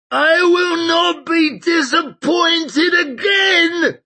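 put-on high, slow, shrieking old man. A narrator's reading. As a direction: shriek it out, voice cracking. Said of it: "shrieked the old man".

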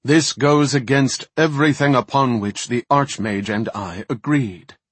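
loud, commanding voice from everywhere; booming male voice. Acted. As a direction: boom it down like a judge. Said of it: "boomed a voice from every direction".

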